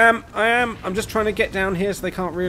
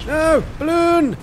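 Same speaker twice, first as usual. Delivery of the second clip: distressed; 14 semitones higher, 5 dB louder, much slower than usual. Real, not acted. Balloon hit in game.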